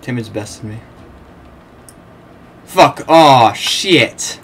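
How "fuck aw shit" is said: loud exasperated swearing; real annoyance at being beaten; frustrated curse. Real, not acted. Losing a fight in game.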